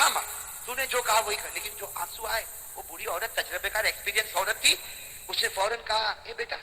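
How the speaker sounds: usual speaking voice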